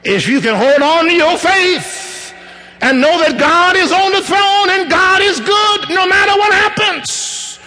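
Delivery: high, loud, ringing preacher's cry; preaching at full force. Acted. Preacher urging the church to hold on, full-force preaching. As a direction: lift the congregation's faith with force. Opening exhortation, raised voice.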